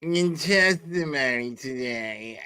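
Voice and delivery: slow, drawn-out mock of her delivery; mocking Kate Peter. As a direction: stretch it out to make fun of her. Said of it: "why does she talk like".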